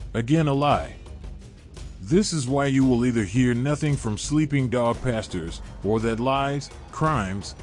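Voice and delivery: ordinary delivery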